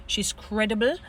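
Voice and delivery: ordinary talk, nothing marked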